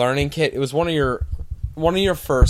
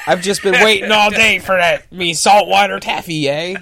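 pirate voice; 5 semitones higher, 5 dB louder than usual. Pretend. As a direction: growl it like an old pirate. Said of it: "I sound like a pirate there".

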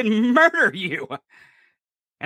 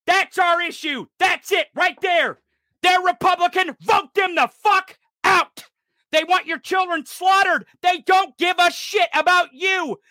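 higher, shouted and near tears; angry shout; 6 semitones higher than usual. Real, not acted. Vlogger urging viewers to vote them out, angry tearful shout. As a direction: yell it like a rallying cry, voice cracking.

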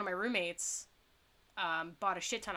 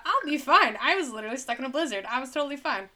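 cocky self; 5 semitones higher, 4 dB louder than usual. Retold or imitated. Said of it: "my dumbass is like".

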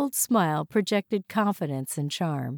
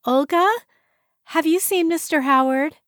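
higher, louder call to someone below; calling downstairs; 10 semitones higher, 6 dB louder than usual. Acted. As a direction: call out a question down the stairs. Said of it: "yelled down to her".

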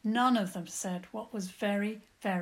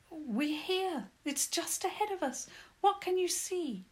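higher, eager, fussy little mole; lightly voiced mole, relieved and weary; 8 semitones higher than usual. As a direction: play the lost mole, grateful and tired. Mole character voice.

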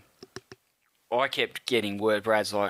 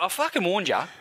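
higher, exasperated told-you-so; retold mate's fed-up warning, pitched up; 9 semitones higher than usual. [name] retelling his warning to the fans, exasperated.

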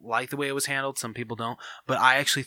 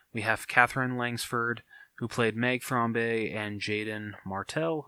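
ordinary talk

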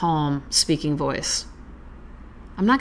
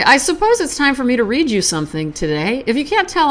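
ordinary talk

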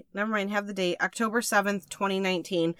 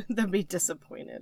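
laughing; 5 dB quieter than usual. Real, not acted. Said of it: "Oh, my God".

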